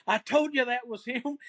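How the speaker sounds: mildly excited mock outburst; imitated freaking out. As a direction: imitate an excited son telling his dad. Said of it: "his son starts freaking out".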